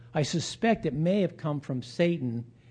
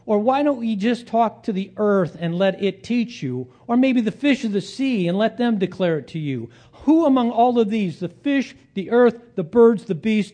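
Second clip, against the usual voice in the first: raised, mounting rhetorical challenge; mocking challenge; 7 semitones higher, 6 dB louder than usual. Job tells friends to ask the animals, mocking challenge. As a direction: build the taunt, higher and louder.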